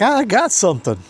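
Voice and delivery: high, eager mock call of a hooked fish; light imitation of someone yelling they've got a bite; imitating excited anglers. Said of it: "And they're like".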